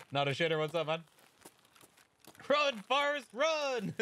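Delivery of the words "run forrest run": very high-pitched urging cry; urgent calling out. Acted. Player quoting a film while fleeing, urgent high call. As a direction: cheer on a runner, voice way up. Urging a run during game.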